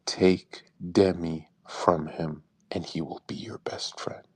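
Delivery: slow, low, conspiratorial whisper; secret whisper. Retold or imitated. Telling his friend the secret to winning Aiden over, whispered slowly. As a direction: lean in and breathe the secret slowly.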